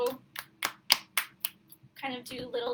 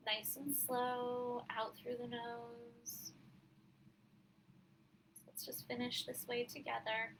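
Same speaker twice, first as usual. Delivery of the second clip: guided breathing meditation; 8 dB quieter than usual. Acted. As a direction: gently lead them through the breaths, unhurried.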